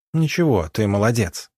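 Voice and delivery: ordinary delivery, nothing marked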